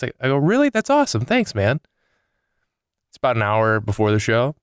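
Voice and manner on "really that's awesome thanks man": high, excited mock gratitude; delighted thanks. Comedian thanks the manager for free tickets, high and excited. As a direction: act thrilled at the news.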